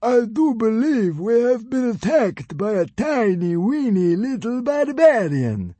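slow high mouth-full voice; fat Roman drawl. A narrator's reading. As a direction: drawl lazily while eating. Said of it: "talking with his mouth full".

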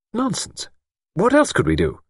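high-pitched brush-off; dismissive. A narrator's reading. Young woman answers older woman's blame, dismissive. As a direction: wave the reproach away.

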